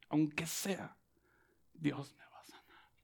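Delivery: hushed whisper to oneself; very soft, breath-only declaration of faith. Acted. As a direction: whisper it as a private conviction. Voicing private faith in healing.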